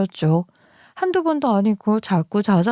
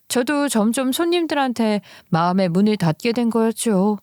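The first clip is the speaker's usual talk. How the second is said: ordinary delivery, nothing marked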